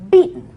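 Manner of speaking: beaten punched high and loud; emphatic. Acted. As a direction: hit "beaten" hard. Repeated word, emphasis.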